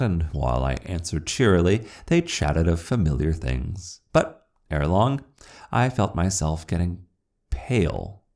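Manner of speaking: ordinary delivery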